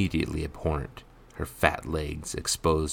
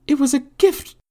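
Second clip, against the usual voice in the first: very high, loud protest; recoiling woman; 10 dB louder than usual. A narrator's reading. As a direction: defend yourself in a high, loud voice. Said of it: "Giselle recoiled".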